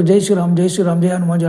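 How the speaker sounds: ordinary delivery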